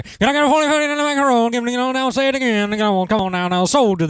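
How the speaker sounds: fast auctioneer patter. Pretend. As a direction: rattle it off like an auction caller. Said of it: "Sound like an auctioneer".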